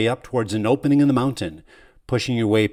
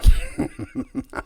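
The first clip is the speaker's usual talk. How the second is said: breathless, voiceless shaking laugh; silent wheezing laugh; 3 dB louder than usual. Acted. Dying servant laughs at the hero's hope. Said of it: "shakes with a silent laughter".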